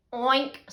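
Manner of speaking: short, loud oink; hog's oink. A narrator's reading. Hog after the thieves are caught, loud oink. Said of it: "said the hog".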